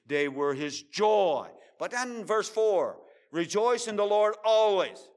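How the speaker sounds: loud, slow, high preacher's call; loud slow sermon cadence; preaching at full force. Acted. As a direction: proclaim it to the congregation.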